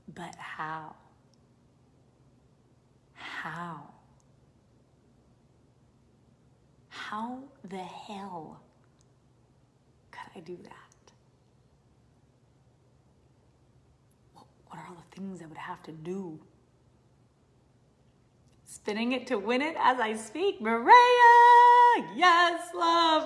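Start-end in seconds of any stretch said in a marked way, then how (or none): 0.1-16.4 slow hushed whisper of self-doubt; doubting inner voice. Retold or imitated. Mind whispering doubts about reaching big goals. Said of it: "You've all asked yourself this question". 20.6-23.2 high, loud cheer; excited shout-out. Real, not acted. Cheering a commenter named Maria, loud and high.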